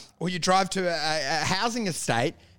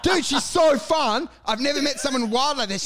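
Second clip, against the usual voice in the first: besotted raving; 7 semitones higher than usual. Acted. English mate tells friend about girl, high besotted gushing. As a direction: rave like a smitten mate.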